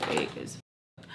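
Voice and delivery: breathy whisper under the breath. Retold or imitated. Whispering to herself about the fake friends. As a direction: mutter it voicelessly to yourself.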